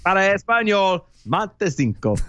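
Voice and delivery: Spanish automated prompt; phone menu voice. Pretend. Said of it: "how it goes exactly in Spanish".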